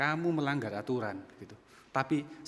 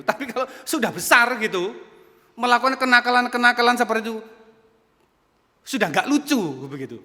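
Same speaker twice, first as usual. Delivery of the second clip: loud, high preaching at full force; 8 semitones higher, 11 dB louder than usual. Real, not acted. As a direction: drive the point home, loud and high. Berkhotbah dengan penuh semangat. (khotbah lantang dengan nada tinggi)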